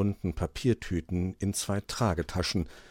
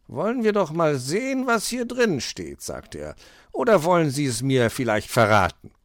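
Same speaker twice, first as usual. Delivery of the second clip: higher fox voice, curious and teasing; fox character voice; 7 semitones higher, 6 dB louder than usual. Acted. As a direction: tease the magpie about the letter. Fox voice opening letter.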